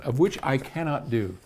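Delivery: ordinary talk, nothing marked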